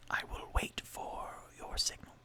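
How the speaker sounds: hushed whisper, barely voiced. Mage whispers to scout before sneaking in, whisper. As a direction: keep it secret and low.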